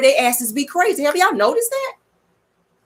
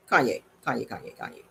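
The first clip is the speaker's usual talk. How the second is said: fading calls; 9 semitones lower, 11 dB quieter than usual. Retold or imitated. Calling his name, fading.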